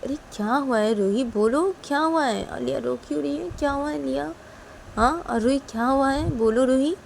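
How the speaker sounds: high, swooping mother's voice; worried questioning. Acted. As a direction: coax out an answer, anxiously. Mother presses girls for answer.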